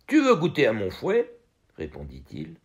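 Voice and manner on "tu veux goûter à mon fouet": menacing coachman. Acted. Cocher menace le garçon.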